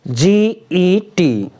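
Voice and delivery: slow spelling. Shown as a demonstration. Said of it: "इसको हम क्या पढ़ते हैं".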